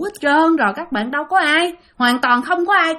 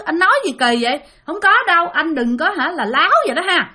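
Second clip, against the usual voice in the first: higher-pitched, agitated protest; a fast, flustered denial pitched up; jumping up upset; 6 semitones higher, faster than usual. Retold or imitated. Wife denying her husband's story, agitated and high. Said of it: "bắt đầu nhảy cẫng lên".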